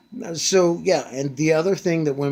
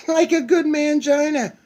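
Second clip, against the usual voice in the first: mocking jab; 6 dB louder than usual. Real, not acted. Mocking jab after laugh.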